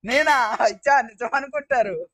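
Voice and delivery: loud, high-pitched real protest; indignant protest. Real, not acted. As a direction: burst out in disbelief at the accusation. Protests a teasing accusation. (గట్టిగా, ఆశ్చర్యంతో అభ్యంతరం)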